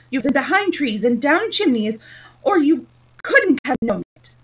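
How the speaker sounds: loud, high accusing outburst; sudden passion. A narrator's reading. Humpty Dumpty accusing Alice of eavesdropping, sudden passion. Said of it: "breaking into sudden passion".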